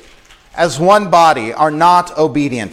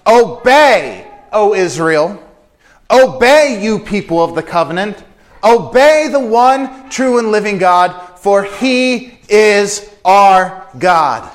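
loud, higher proclaiming call, slowing at the end; 4 semitones higher, 4 dB louder than usual. Retold or imitated. Moses calling Israel to obey the one God, loud and high. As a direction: summon the people to obey. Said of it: "he is calling out to the people".

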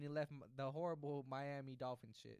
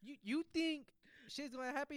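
much higher, loud incredulous challenge; mock outrage; 10 semitones higher, 5 dB louder than usual. Imagined LeBron challenging Adam Silver, mock outrage. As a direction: demand it in hammed-up disbelief. Voicing LeBron boasting to the commissioner.

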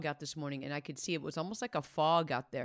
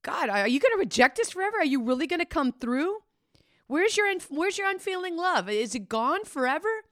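loud, higher, fast desperate plea to God; Asaph pleading; 11 semitones higher, 8 dB louder than usual. Acted. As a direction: cry out the doubt to God. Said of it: "Asaph is like".

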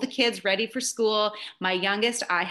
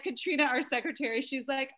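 usual speaking voice

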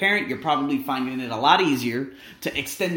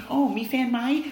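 high, loud teasing nurse; flirty nurse; 11 semitones higher, 5 dB louder than usual. Retold or imitated. Nurse flirting with him, high and teasing.

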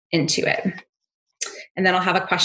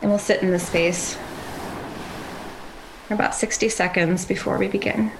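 calm grounding exercise. Acted. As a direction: gently guide them to settle. Leads a grounding meditation.

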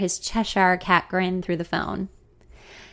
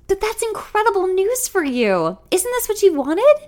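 put-on very high, gushing girlish voice; innocent cheerleader; 12 semitones higher, 5 dB louder than usual. A narrator's reading. Junior girl congratulating her senior, innocent and gushing. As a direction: gush innocently like a freshman cheerleader. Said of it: "she asks innocently, like a freshman cheerleader".

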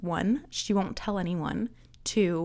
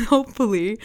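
laughing; 3 semitones higher, 8 dB louder than usual. Real, not acted. Laughs at own slip.

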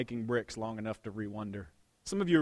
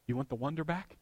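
ordinary talk, nothing marked

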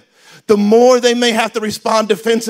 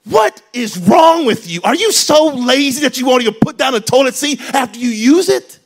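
loud, high, scolding outburst; critical attack; 4 semitones higher, 6 dB louder than usual. Shown as a demonstration. Spouse attacking partner over the toilet seat, angry criticism. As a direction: lay into them, drawing out the first words hard.

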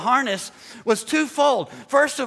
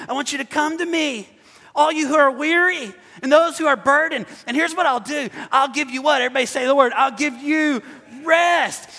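raised, emphatic preacher voice; impassioned preaching; 4 semitones higher, 3 dB louder than usual. Real, not acted. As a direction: proclaim the invitation to the crowd. Preaches quoted scripture with force.

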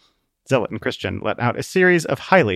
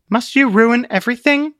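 exasperated; 12 semitones higher, 6 dB louder than usual. Acted. Truth scolds Zealot after slap.

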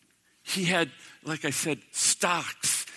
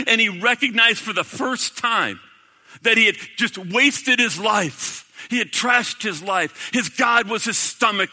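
louder, higher, driving sermon voice; preaching at full force; 6 semitones higher, 7 dB louder than usual. Acted. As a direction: preach it out, rising with conviction.

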